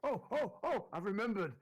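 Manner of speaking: high excited burst; sudden realization. Real, not acted. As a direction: blurt it out as it comes back. Player suddenly recalls backstory.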